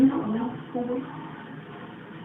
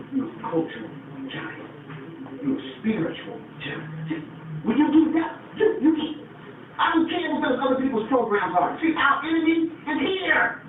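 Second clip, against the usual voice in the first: ordinary talk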